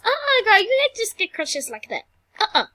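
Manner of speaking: mock sassy refusal. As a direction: scold her like a sassy nurse. Sassy scolding a patient.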